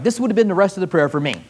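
ordinary delivery

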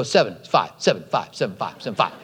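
low, muttered back-and-forth; mock bickering. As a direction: rattle off both sides softly in a low voice. Retold back-and-forth argument with friend.

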